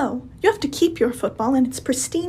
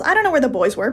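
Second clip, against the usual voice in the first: ordinary talk, nothing marked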